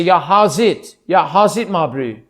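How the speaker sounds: lively South African greeting; South African accent. Shown as a demonstration. Showing how South Africans say hello, South African accent. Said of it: "South Africans will also say".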